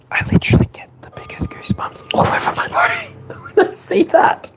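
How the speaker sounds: fast, breathless high whisper; excited whisper. Real, not acted. Streamer whispering thanks to Jane, fast and breathless. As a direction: gush it in a rushed whisper. Overwhelmed streamer gushing to donor.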